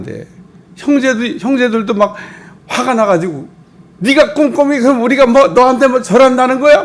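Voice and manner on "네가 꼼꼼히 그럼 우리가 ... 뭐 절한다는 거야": 훨씬 높고 크게 몰아붙이는 분노; 기가 막혀 언성을 높여. Retold or imitated. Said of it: "막 이러고 막 화가 났는데". (요셉의 꿈 얘기에 분노한 형들이 소리침)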